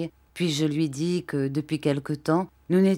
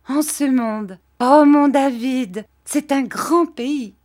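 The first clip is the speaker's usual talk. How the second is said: Dora gaie; 7 semitones higher, 7 dB louder, slower than usual. A narrator's reading.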